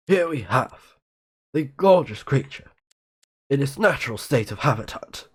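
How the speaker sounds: lightly hushed nature-documentary narrator; David Attenborough impression. Streamer reads the texts as a nature documentary narrator. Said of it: "If you know what voice I'm doing".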